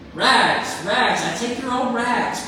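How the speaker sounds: bleeding ragman crying his wares as he goes. Acted. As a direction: cry it out though hurting. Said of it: "cried the sobbing, bleeding, strong ragman".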